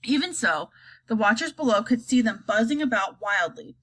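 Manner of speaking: plain reading voice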